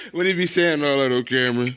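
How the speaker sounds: laughing. Real, not acted.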